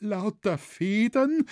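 high, slow frightened question; anxious voice. A narrator's reading. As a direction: cry it back in dismay. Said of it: "rief der Kramer mit ängstlicher Stimme".

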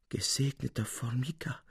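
soft, murmured under his breath; lisping to himself. A narrator's reading. Capuzzi blessing Formica under his breath, softly. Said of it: "lispelte Signor Capuzzi vor sich hin".

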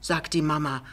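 usual speaking voice

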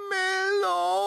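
very high, loud squeaky ghost cry; pitiful little ghost. Acted. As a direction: wail it like a tiny trapped ghost. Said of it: "ein jämmerliches".

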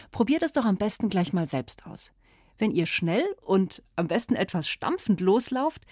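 usual speaking voice

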